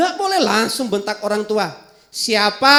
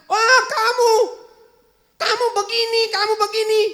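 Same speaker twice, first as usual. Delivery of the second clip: high teasing jeer with a laugh; pitched-up giggling taunt; mocking taunt; 10 semitones higher than usual. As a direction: sneer at a classmate. Child mocks friend.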